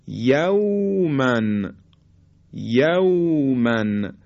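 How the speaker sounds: "یومن" with a soft, slow glide from a into w; soft līn. Shown as a demonstration. Teacher models waw after fatha in "یومن", soft līn. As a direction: stretch the aw of "یومن" gently.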